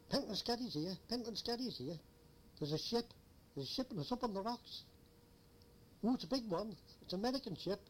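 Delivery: mock agitated distress call; flustered radio report. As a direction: repeat it urgently, as if into a radio. Acted excited radio report of wreck.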